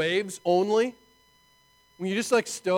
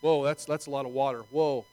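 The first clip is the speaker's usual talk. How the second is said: awed dread; 6 semitones lower, 5 dB quieter than usual. Retold or imitated. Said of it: "that's all you begin to see".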